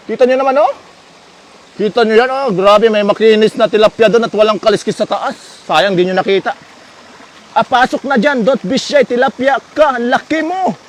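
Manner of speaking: normal talking voice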